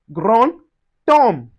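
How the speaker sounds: "grand tome" as gron, tom; D of "grand tome" sounded as T; linked pronunciation. Shown as a demonstration. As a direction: pause after gron, then a clear T in "grand tome". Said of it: "we pronounce T".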